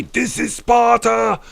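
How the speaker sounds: high, drawn-out battle cry; Sparta movie-line impression. Streamer quoting the film back to Scotty, mock-epic cry. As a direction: roar it slowly like a warrior king. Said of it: "You got the reference".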